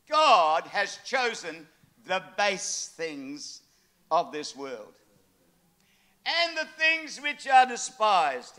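slow, weighty reading of scripture; solemn slow preaching read. Acted. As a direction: lay each phrase down slowly.

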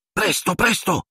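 high, pleading whine; urgent moan. A narrator's reading. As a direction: moan it in a high voice, desperate to hurry. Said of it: "gemette Hermione". (gemito acuto e pressante)

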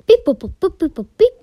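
loud beeping computer noises; robot voice. Pretend. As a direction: beep like a scanning machine. Scanning reservations like a computer.

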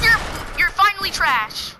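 high, loud gleeful taunt; taunting shout. Real, not acted. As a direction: crow it over the beaten enemy. Taunting an opponent in game.

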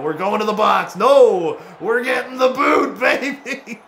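high, rising, laughing shout; gleeful hollering. Real, not acted. Celebrates being sent to the box.

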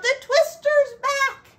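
high, loud panicked rooster yell. Acted. Rooster yelling to the farm that the twister's back. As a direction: scream the warning like a rooster. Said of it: "The rooster yelled".

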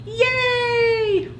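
high, drawn-out little yay; gleeful cheer. Acted. Plankton will get a bedtime story, gleeful cheer. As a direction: cheer in a high, held note.

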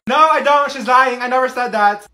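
high, loud protest; panicked denial. Acted. Shy guest saying friend is lying, panicked shouting. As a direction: shout over her to deny it.

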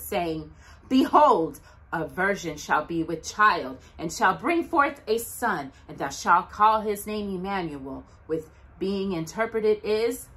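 ordinary delivery

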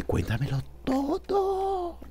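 high, soft and slow, begging for gossip; hushed eager squeal for the dirt; hushed high eager plea; breathless eager plea. Acted.

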